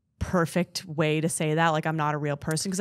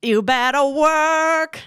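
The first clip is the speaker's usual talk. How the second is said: high, slow, sassy drawl; campy sassy voice; 7 semitones higher, 5 dB louder, slower than usual. Pretend. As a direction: strut it like a diva. Campy drag-style shout.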